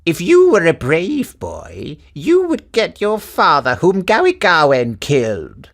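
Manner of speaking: put-on high, loud, slow scolding old woman; angry old woman. A narrator's reading. Old woman furious at the boy who hit her pot. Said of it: "became very angry and cried".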